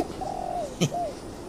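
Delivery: high teasing giggle, rising; teasing laugh. Acted. Teasing his friend about a girl at the office, high giggle. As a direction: giggle up high while you tease him.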